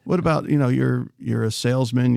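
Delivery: ordinary delivery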